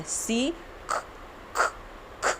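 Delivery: ख with a puff of air after the k; C as kha sound. Shown as a demonstration. Teacher showing kids the C sound, breathy ख. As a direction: say सी then ख, a breathy mix of k and kh. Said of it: "क और ख दोनों की mixture sound".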